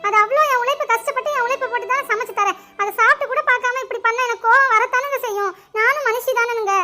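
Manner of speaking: ordinary talk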